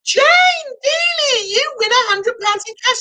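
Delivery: high-pitched winner reveal; excited announcement. Real, not acted. Host revealing the cash prize winner on stream, excited high cheer.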